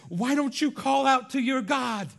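high, loud, harsh roughneck bark; rough sailor, not nice. Retold or imitated. Pagan sailor rudely wakes Jonah, harsh loud bark. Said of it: "with not nice words and a not nice attitude".

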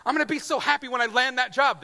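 high, loud, fast eager promise; eager hope. Retold or imitated. Preacher mimics a job seeker, eagerly loud. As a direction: sell the dream of the job to yourself.

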